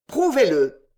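loud insistent repeat of the demand; heated repeat. A narrator's reading. Winkle pressing the editor, loud insistent demand. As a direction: hammer the demand home. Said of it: "s'écria Monsieur Winkle avec chaleur".

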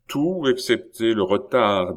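ordinary delivery, nothing marked

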